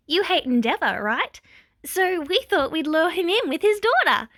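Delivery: put-on high, bright cheerful girl voice; chirpy villain cheering; gleeful high girl voice. A narrator's reading. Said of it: "Toga cheered".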